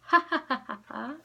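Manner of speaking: hammed-up laugh; a short mocking chuckle; mock raccoon laugh. Shown as a demonstration. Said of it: "Can you all give me a laugh?".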